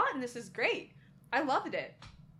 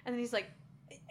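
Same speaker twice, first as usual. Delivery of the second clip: ordinary talk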